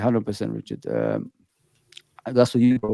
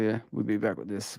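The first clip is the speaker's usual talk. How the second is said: ordinary talk, nothing marked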